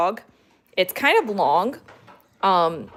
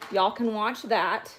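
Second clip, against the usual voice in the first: ordinary talk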